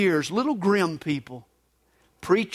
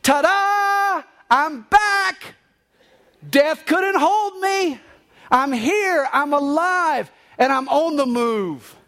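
very high, exultant proclamation; exultant cry easing down as it ends; jubilant Jesus; 8 semitones higher, 3 dB louder, slower than usual. A narrator's reading. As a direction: ring it out in high, drawn-out triumph. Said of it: "He's laughing loudly".

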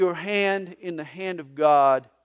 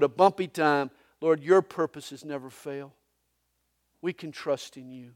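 earnest pastoral prayer; 4 dB louder than usual. Real, not acted. Pastor praying over the congregation. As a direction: pray it sincerely for the room.

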